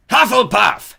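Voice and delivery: loud, high drawn-out shout; hat shouting. A narrator's reading. The hat shouts its verdict to the hall. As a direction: announce the house with a booming cry. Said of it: "shouted the hat again".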